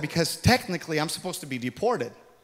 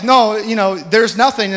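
lady insisting; 6 semitones higher, 10 dB louder, slower than usual. Retold or imitated. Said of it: "she's like".

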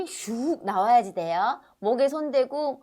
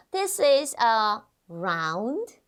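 slow model phrase; much slower than usual. Shown as a demonstration. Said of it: "teacher models slow phrase".

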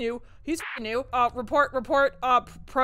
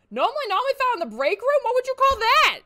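panicked call-out getting louder; rising alarm to shout; 6 semitones higher, 6 dB louder than usual. Real, not acted. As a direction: pitch climbing into a shout. Spotting anomaly, rising panic.

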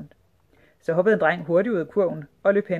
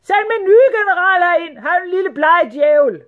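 high, booming sultan yell; shouted order; 13 semitones higher, 11 dB louder than usual. A narrator's reading. Sultan summoning his new general, shouting. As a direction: bellow the summons at high pitch. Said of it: "I stedet råbte han".

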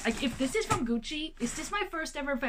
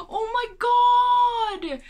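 a sharp gasp, then a drawn-out, much louder and higher oh my god; slow, shrieking thrill; excited scream; 10 semitones higher, 9 dB louder, much slower than usual. Real, not acted. Realizing who sent it.

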